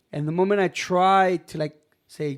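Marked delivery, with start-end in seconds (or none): none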